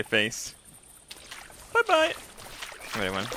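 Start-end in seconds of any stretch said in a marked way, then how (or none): 1.7-2.1 very high squeaky goodbye; cutesy farewell. Pretend. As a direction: send the duck off in baby talk. Waving the duck off.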